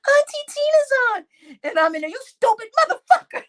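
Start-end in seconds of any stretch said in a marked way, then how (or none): 0.1-1.3 high, excited little nephew; high excited child announcing auntie; child's voice. Retold or imitated. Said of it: "my nephew said". 2.1-3.4 high, loud laughing cuss; mock angry cussing while laughing; cussing someone out. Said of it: "I'm in the background cussing him out".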